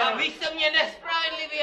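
shouted. Pretend. Injured man shouts complaint.

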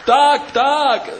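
Pták Pták yelled very high and loud in pain. Acted. Bird Pták crushed by the others, high loud yell. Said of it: "zařval bolestí Pták".

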